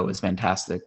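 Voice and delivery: ordinary delivery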